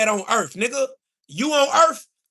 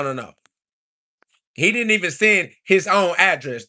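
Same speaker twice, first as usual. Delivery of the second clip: ordinary talk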